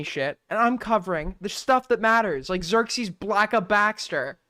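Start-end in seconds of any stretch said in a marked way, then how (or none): none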